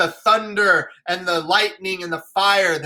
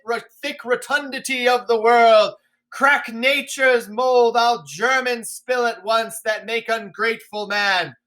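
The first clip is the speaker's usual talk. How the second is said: defiant storm-shouting king; screaming at the heavens; 6 semitones higher, 3 dB louder than usual. Acted. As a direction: rail at the sky with everything. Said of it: "So he's screaming at the heavens".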